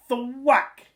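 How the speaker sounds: high, loud thwack; axe thwack. A narrator's reading. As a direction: hit it hard. Axe hitting beanstalk.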